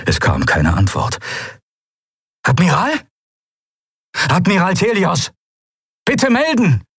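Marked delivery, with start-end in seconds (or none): 2.4-6.8 high call, mounting urgency; urgent radio call. A narrator's reading. Commander calling the silent admiral, increasingly urgent. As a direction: hail someone who will not answer.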